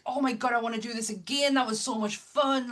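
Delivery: high, fast, thrilled audience member; excited. Retold or imitated. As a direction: gush like a thrilled moviegoer.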